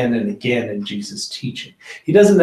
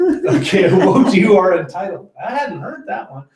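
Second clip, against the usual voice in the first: higher, laughing through the words; 9 semitones higher than usual. Real, not acted. Cracking up as a friend jokes about entitled rich. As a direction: chuckle while repeating the joke.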